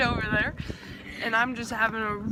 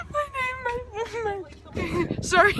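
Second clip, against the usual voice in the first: laughing. Real, not acted. Breaking up laughing.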